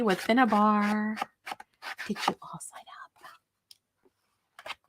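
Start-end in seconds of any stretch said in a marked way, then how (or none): 2.1-4.8 breathy, slow stage whisper of a question; hushed conspiratorial whisper. Acted. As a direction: lean in and whisper it to the chat like a secret. Stage-whispered aside to chat.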